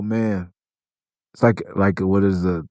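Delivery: ordinary talk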